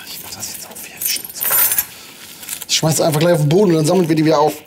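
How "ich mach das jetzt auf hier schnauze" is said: whispered grumble under the breath; whispering. Real, not acted. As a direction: whisper it to yourself while busy. Fiddling with tool, muttering.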